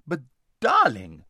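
loud, drawn-out protest; same loud voice. A narrator's reading. Lazareline objecting to her friend's hushing, loud voice. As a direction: gush it loudly, stretching the word. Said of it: "in the same loud voice".

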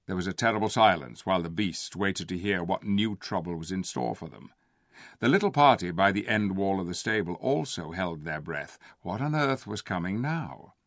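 usual speaking voice

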